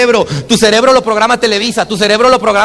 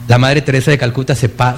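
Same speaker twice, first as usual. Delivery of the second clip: normal talking voice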